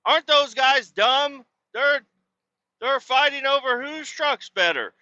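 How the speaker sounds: put-on high, scornful girly voice; mock the guys in a prissy high voice; lightly voiced teen girl sneering at the boys. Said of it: "They say".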